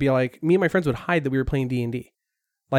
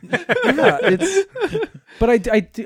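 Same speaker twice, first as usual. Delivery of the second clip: high laughing words; 12 semitones higher, 5 dB louder, much slower than usual. Real, not acted. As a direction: talk through the laughter.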